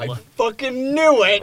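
loud, high exclamation; real excited shout; vindicated outburst. Real, not acted. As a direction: burst out as your suspicion proves true. Troll revealed, player exclaims.

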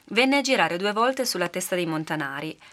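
plain reading voice

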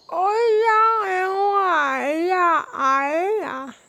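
strange echoing spirit voice; eerie ancient voice. Acted. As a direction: call like a distant spirit. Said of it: "¿Qué dijo?".

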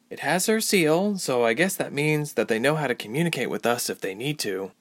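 lightly voiced Ilse.

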